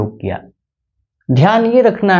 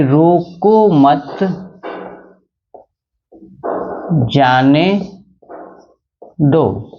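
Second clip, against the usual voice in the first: dictation pace; much slower than usual. Shown as a demonstration. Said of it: "अल्प विराम का प्रयोग".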